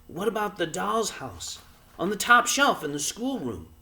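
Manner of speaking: put-on high young boy's voice; a boy offering a bright idea; boy suggesting. A narrator's reading. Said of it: "suggested the boy".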